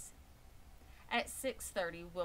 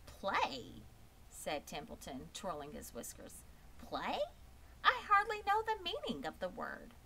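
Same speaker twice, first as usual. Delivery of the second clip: a sly rat scoffing, jumping higher; 6 semitones higher than usual. Acted. Templeton the rat scoffs at Wilbur, sneering. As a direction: sneer at the idea of play.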